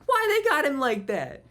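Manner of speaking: high, loud, drawn-out outraged yell; hammed-up shrieking impression, words stretched out. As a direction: yell in disbelief through the phone. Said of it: "He's just yelling at, like, through the phone".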